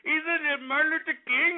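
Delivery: high, slurred mock Mexican accent; hammed-up, high-pitched Mexican accent. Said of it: "these dumbass Mexicans here saying".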